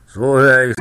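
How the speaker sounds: loud, pleased exclamation; called out. A narrator's reading. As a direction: call it out gleefully. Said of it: "rief der Bauer". (lauter freudiger Ausruf)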